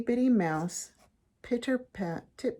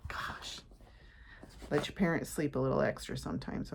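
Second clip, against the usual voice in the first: whispering; 6 semitones lower, 7 dB quieter than usual. Real, not acted. Whispered aside.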